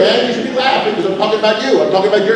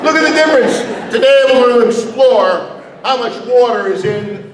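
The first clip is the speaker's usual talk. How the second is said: excited teacher; 3 semitones higher than usual. Pretend. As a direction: hype the kids up about water.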